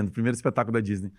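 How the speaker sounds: ordinary delivery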